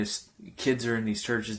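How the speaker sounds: normal talking voice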